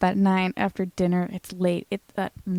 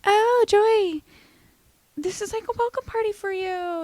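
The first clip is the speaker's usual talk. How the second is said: much higher, mock innocent excuse; fake-sweet group voice; 11 semitones higher than usual. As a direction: play the caught friends, all sugary. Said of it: "And they're like".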